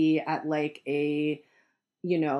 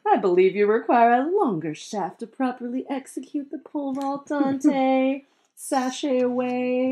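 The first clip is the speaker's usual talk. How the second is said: high, sassy drag queen read; shady drag queen voice; 6 semitones higher, 3 dB louder than usual. Acted. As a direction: throw shade like a drag queen. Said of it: "a shady drag queen voice".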